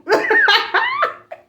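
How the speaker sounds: a high squealing burst of laughter. Real, not acted. Laughs at the slip.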